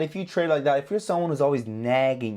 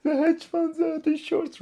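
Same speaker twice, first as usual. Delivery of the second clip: whiny complainer; 12 semitones higher than usual. Retold or imitated. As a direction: mimic a trader crying about hedge funds. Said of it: "cry and cry and blame".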